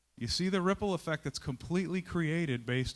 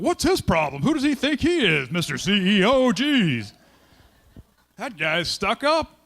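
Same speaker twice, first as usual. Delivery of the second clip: loud, much higher scornful complaint; mocking coworkers; 7 semitones higher, 11 dB louder than usual. Offended coworkers griping about the boss, loud and scornful. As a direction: sneer it out loudly, offended. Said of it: "Now they're walking around going".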